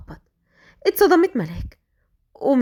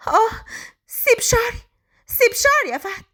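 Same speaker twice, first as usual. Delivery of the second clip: pained cry; 13 semitones higher than usual. A narrator's reading. Hair pulled, crying out.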